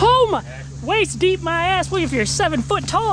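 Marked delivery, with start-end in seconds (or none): 0.0-1.9 shocked at the cold. Real, not acted. Stepping into cold deep water.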